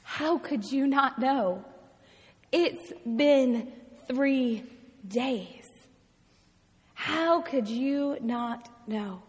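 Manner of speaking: understated, slow disbelief; astounded. Acted. Astounded companions questioning the stranger, hushed disbelief. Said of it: "are astounded".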